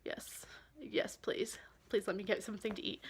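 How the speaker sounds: hushed and breathless, begging for food; whispered pleading. Retold or imitated. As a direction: beg for food under your breath.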